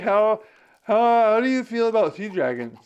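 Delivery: hammed-up high, loud questioner; Josiah Sandy. Friend asks about sea dragons, exaggerated imitation. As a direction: put on a big, exaggerated asking voice. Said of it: "he doesn't talk like this".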